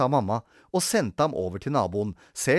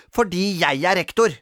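put-on high, loud self-important child; emphatic childish assertion; bossy; 6 semitones higher, 5 dB louder than usual. A narrator's reading. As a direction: pull rank over a playmate. Grete asserts her rank.

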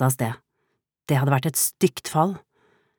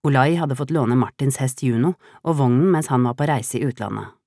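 plain reading voice

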